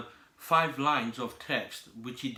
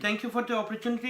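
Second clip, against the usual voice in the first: sarcastic thanks; 7 semitones higher, faster than usual. Acted. Candidate mock-thanks before threatening.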